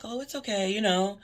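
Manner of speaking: high, sugary service voice; sweet customer voice. Retold or imitated. Forgiving an apologizing customer.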